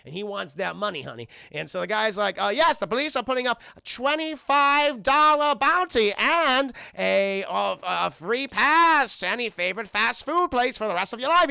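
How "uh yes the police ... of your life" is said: high, emphatic bulletin voice; cartoon news announcer. Show narrator offering the bounty, hammed-up announcer. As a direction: stretch out the prize like an announcer. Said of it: "it's the narrator of the show".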